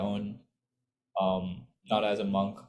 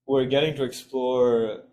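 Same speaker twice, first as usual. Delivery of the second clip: normal talking voice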